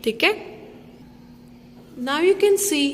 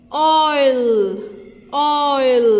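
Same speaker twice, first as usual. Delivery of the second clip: oil said louder; emphatic oil; 5 dB louder than usual. Shown as a demonstration. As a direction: announce oil loudly to the class. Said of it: "The next one is". (ज़ोर से ऑयल)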